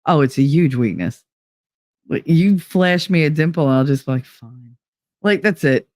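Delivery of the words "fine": defeated fine. Pretend. Giving in to a dimple.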